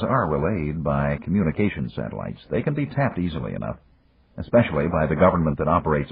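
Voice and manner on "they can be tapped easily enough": ordinary delivery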